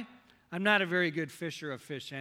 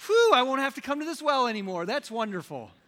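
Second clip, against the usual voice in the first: louder, higher, relieved exclamation; a woman glad to skip the trip to the well; lightly voiced relieved woman; 8 semitones higher, 10 dB louder than usual.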